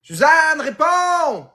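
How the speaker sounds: high-pitched holler to answer; shouted call. Acted. Yelling at Suzanne to get the ringing phone, high holler. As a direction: call out high and loud, drawn out.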